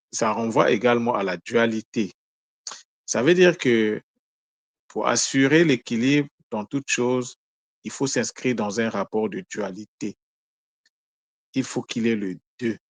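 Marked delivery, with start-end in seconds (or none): none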